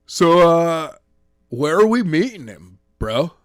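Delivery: a cocky dude who says bro; surfer dude voice. A narrator's reading. The man with the tie asks Daniels, casual surfer bro.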